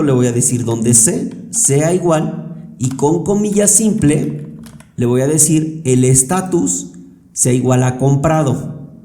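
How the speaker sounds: normal talking voice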